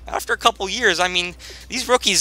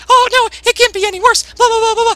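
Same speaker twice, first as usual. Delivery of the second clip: high squeaky mock whine; squeaky nagging mockery; mocking critic voice; 5 dB louder than usual. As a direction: parrot the doubters in a silly high voice. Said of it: "You, you're like".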